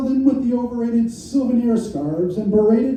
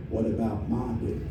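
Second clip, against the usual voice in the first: low, much softer Southern drawl; 11 semitones lower, 9 dB quieter than usual. Retold or imitated. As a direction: drawl the line like the neglected Southern caller. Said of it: "just like the Southern guy did on the party line".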